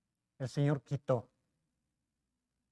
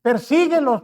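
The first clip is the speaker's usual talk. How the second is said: God commanding; 7 semitones higher, 11 dB louder, slower than usual. Retold or imitated. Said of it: "Y Dios le dijo".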